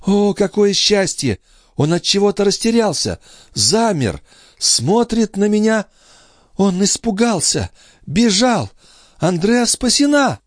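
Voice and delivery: very high woman's character voice; high, excited reliving of an escape; entranced sister, relieved. Acted. Sister in a trance tells how she was saved, high and relieved.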